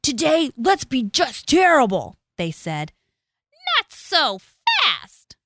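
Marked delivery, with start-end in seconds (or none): 0.0-2.2 high, loud schoolkid voice; mischievous kids. A narrator's reading. Naughty kids plot in a story. 3.8-5.4 high, slow, nasty voice; unpleasant hiss. A narrator's reading. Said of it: "hissed an unpleasant voice".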